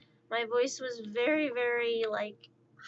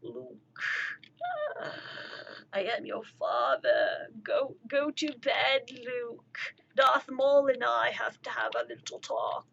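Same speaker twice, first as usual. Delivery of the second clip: Darth Vader; 3 semitones lower, 4 dB quieter than usual. Acted. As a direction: do a hammed-up dark-lord dad. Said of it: "sound like Darth Vader over there going".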